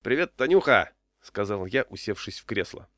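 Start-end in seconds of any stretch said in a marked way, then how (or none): 0.0-0.9 loud, higher, breezy hello; boisterous, familiar greeting; hearty greeting. A narrator's reading. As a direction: hail an old friend loudly.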